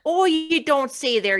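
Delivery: put-on high, slow Minnesota accent. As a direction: draw out a thick Minnesota accent. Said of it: "the worst Minnesota accent".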